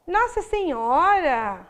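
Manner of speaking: slow, drawn-out admiration; admiring exclamation. Shown as a demonstration. Teacher acting out admiration for students, slow awed exclamation. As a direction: stretch out the exclamation in wonder. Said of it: "são admirações".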